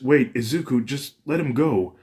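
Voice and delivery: a hint of a worried sister stepping in; Fuyumi pleading.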